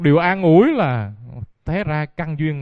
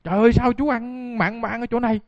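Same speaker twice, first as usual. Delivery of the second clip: scolding; 8 semitones higher than usual. Retold or imitated. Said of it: "Tới la lên cằn nhằn".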